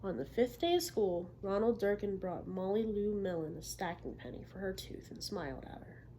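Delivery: lively read-aloud for a class; storybook narration; story reading to children. Acted. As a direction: tell the story to young listeners. Teacher reads picture book aloud.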